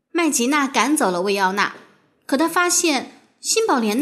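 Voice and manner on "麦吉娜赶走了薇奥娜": ordinary delivery, nothing marked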